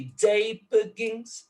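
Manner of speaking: measured "day begins", strong first beat; dactyl stress. Shown as a demonstration. Lecturer scanning the last dactyl, measured "day begins". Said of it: "é também um outro dátilo".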